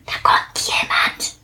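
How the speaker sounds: breathy toneless whisper. Acted. Platsch warns Plitsch of an approaching beetle, whispered. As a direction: whisper a warning so it won't hear. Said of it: "flüsterte er".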